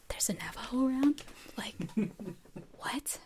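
whispered puzzled aside; confused whisper. Retold or imitated. Reacting to Cicely's remark, whispered bewildered aside. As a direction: hush it like a secret confusion. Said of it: "And I'm like".

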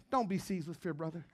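low, hushed comfort; soft reassurance. Retold or imitated. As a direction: speak it gently to a grieving man. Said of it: "you said".

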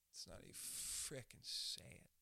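low, slow whisper; hushed delivery. Shown as a demonstration. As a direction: whisper it slowly, leaning on "frickin'". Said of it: "It's not how you say it".

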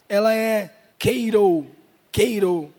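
keiro said slowly and carefully, as a Greek word. Shown as a demonstration. Pastor teaching the congregation a Greek word, slowly pronouncing keiro. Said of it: "A palavra alegrar-se".

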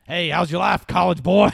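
high, loud jeering bro voice; mock taunt. Calling out to the grown-up sitcom kid in a jeering bully voice. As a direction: jeer at him like a bully. Taunting a former child star.

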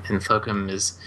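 usual speaking voice

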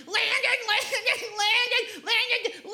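high whining call of a little brother; high-pitched childish whine, name dragged out; whiny kid calling. Retold or imitated.

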